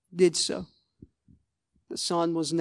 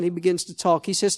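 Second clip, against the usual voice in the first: ordinary delivery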